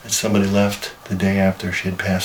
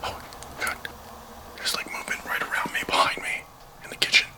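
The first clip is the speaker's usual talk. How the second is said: hushed, breathless whisper; nervous whisper; 8 dB quieter than usual. Real, not acted. Spotting something strange in the dark room, nervous whisper. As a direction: whisper so nothing hears you.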